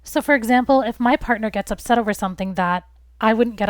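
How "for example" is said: ordinary talk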